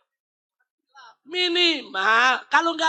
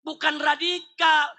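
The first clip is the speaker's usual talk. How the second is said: normal talking voice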